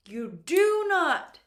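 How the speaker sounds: "do not" high, loud and slow; emphatic prohibition. Real, not acted. Teacher warning learners off a mistake, emphatic "do not". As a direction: lay down the rule, punching "do not".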